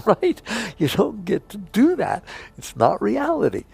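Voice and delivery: amused disbelief, laughing it off. Real, not acted. Teacher laughs at the absurdity.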